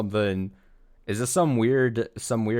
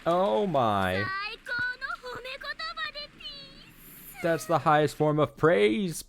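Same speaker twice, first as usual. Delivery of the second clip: delighted character voice; slower than usual. Acted. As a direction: savor the compliment.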